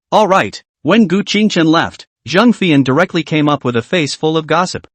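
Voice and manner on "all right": ordinary delivery, nothing marked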